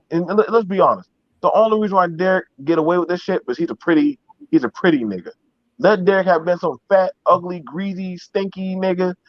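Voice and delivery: ordinary talk, nothing marked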